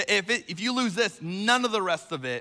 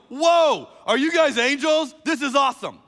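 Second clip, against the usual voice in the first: loud, high-pitched mock astonishment; imagined amazement; 7 semitones higher, 6 dB louder than usual. Imagined Mary gushing at two angels by the tomb, excited. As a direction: burst out, hammed-up, like an awestruck fan. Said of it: "Because she never goes".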